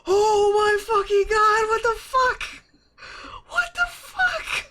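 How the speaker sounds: shrieking, high terrified screams breaking into laughter; panicked shriek that cracks into hysterical laughing; screaming in terror. Real, not acted. Finding a severed hand in the dark, shrieking and laughing in terror. Grabbed a slimy hand in dumpster.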